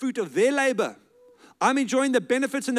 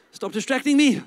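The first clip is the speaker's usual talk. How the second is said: raised, higher mock scolding; mock telling-off; 6 semitones higher, 6 dB louder than usual. Preacher to a distracting audience, mock scolding. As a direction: playfully tell off the crowd.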